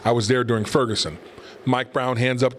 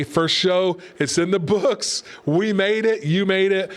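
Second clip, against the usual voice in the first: higher, buoyant celebration of making it; excited sign-off; 7 semitones higher, slower than usual. Real, not acted. Host thanking viewers after his first show, higher and elated. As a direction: lift the voice up, proud and happy.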